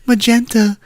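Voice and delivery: high, slowed put-on sales pitch; English stripper. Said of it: "I'm just an English stripper".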